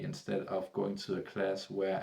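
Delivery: ordinary talk, nothing marked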